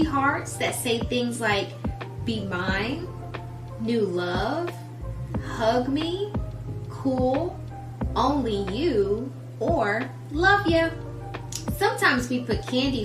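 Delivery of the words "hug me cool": ordinary delivery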